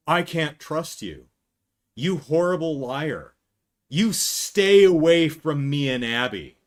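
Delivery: stern mock accusation. Mike shutting Vanessa out, slow, loud mock sternness. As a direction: push her away like a wronged hero. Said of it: "Mike turns around and says".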